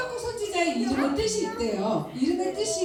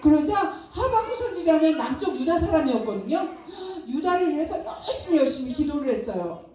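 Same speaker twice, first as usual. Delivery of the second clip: ordinary talk, nothing marked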